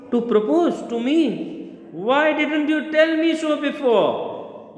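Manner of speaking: high, slow astonished disbelief; rising shock as the news sinks in. Acted. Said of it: "so she is astonished".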